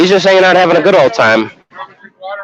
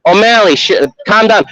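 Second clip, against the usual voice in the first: ordinary delivery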